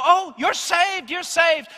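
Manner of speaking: mock. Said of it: "I don't go".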